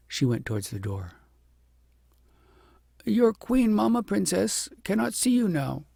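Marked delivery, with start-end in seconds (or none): 3.0-5.9 higher, firm servant voice; a nurse gently refusing. A narrator's reading. Nurse tells princess.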